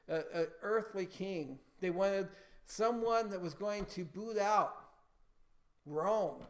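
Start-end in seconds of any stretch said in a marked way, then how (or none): none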